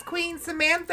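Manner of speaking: high squeaky cat voice. Retold or imitated. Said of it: "The cat was like".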